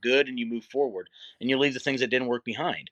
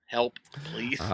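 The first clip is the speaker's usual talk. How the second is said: whispered plea. Acted. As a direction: mock-desperate whisper. Acts out reluctant plea to self.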